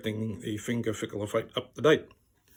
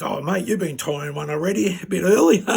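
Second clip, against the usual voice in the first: mock-matey pub banter, higher and loud at the end; jovial stranger; 5 semitones higher, 8 dB louder than usual. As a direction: imitate a bloke ribbing his mate, voice climbing louder. Stranger's jovial ribbing, acted.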